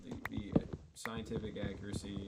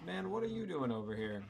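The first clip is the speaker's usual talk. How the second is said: high-pitched indignant challenge; indignant confrontation; 7 semitones higher than usual. Real, not acted. Player confronting an intruder in the game, indignant. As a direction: call out someone intruding, pitch jumping up.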